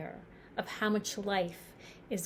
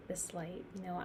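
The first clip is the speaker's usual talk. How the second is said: ordinary delivery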